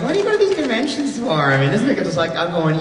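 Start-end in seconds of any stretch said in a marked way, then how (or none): 0.0-1.3 high, puzzled mock question; puzzled outsider. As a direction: play the baffled friend back home. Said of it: "they're like".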